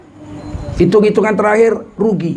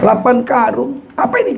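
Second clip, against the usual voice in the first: high incredulous question; can't believe the pile; astonished; 4 semitones higher than usual. Retold or imitated. Teacher stunned at eight sacks of money, incredulous.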